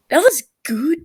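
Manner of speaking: bright burst of pride on was; excited praise. Acted. Tommy gloating to Techno over the healing, excited. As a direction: blurt it out thrilled.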